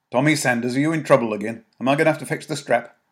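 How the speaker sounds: a weary mum ready to scold; scolding mother. Acted. Mother scolding Tommy, loud and sharp.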